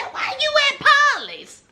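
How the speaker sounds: high, fast mock shout; high-pitched incredulous yell. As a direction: yell it in disbelief. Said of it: "I said".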